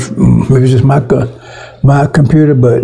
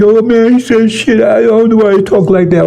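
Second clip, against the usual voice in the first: mocking the offended; 7 semitones higher than usual. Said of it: "those of you that be offended".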